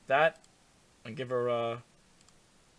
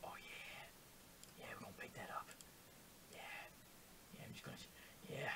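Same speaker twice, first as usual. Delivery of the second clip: very soft, low seductive murmur; mock-sultry whisper; 6 semitones lower, 13 dB quieter, faster than usual. Player flirting with the face being painted, mock-sultry whisper. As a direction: whisper it like pretend pillow talk.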